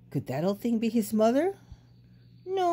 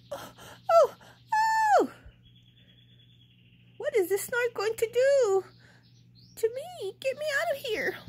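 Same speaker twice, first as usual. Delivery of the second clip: high, frightened cries; panicking as he is lifted away; scared baby bird. A narrator's reading. Baby bird carried off by the snort, high and frightened.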